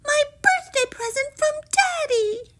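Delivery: very high princess wail; high-pitched, distraught moan; dismayed groan. A narrator's reading. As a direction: lament it in a high voice. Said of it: "Poppy groaned".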